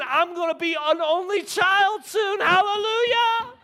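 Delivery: loud, high gleeful mock cheer; a sister delighted by the prospect; gleeful sibling. Sister imagined cheering, loud mock glee. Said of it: "Zariah is thinking".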